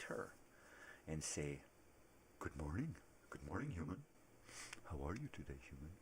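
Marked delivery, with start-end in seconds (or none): 2.4-5.9 lightly voiced talking dog; swooping, rising dog greeting; put-on pet voice saying hello; Bessie. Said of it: "That's how Bessie talks".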